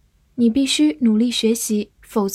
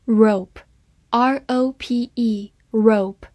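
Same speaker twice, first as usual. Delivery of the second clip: slow spelling; much slower than usual. Shown as a demonstration. Spelling drill.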